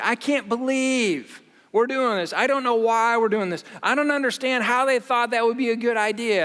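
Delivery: mock-outraged complaint, pushed up and loud; frustrated venting. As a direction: vent about the boss at the kitchen table. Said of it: "share my frustration with my wife".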